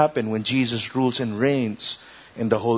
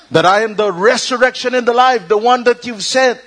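much higher, louder proclamation; proclaiming; 9 semitones higher, 6 dB louder than usual. Acted. Jesus praying aloud so the crowd hears, proclaiming. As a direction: declare it over the crowd. Preacher voicing Jesus's prayer.

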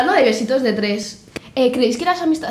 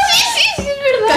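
much higher, louder, laughing yes; excited laughing agreement; 4 dB louder than usual. Real, not acted. Friend gushes agreement about the acting casting call. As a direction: gush agreement through laughter.

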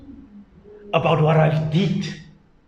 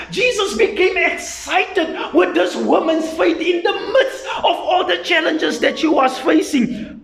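raised, ringing sermon voice; full-force sermon delivery; fiery preaching; 12 semitones higher, 4 dB louder than usual. Acted. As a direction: preach it out to the congregation.

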